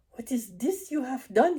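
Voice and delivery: high, loud hammed-up German accent; Herr Farnan's corny German accent. Dreamed vet confronting the narrator, corny German accent. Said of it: "such a completely corny accent".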